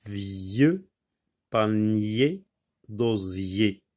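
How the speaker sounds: "Vieux" "panier" "d'osier" each said alone with a pause after, the y sound drawn out; sounds isolated. Shown as a demonstration. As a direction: split "Vieux panier d'osier" into three separate slow words. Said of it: "On entend plusieurs fois le son y".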